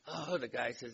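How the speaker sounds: normal talking voice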